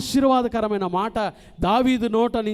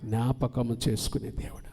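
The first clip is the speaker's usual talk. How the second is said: normal talking voice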